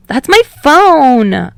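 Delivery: loud, high indignant shout; outraged yell. Acted. Kaminari sees his phone crushed, yelling in outrage. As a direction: yell it in protest.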